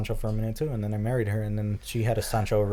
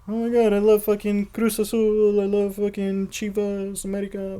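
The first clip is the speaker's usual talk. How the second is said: mock soccer fan; 10 semitones higher than usual.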